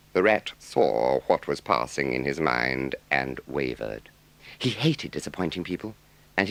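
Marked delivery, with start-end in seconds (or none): none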